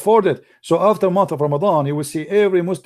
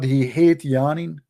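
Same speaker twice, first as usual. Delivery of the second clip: ordinary talk, nothing marked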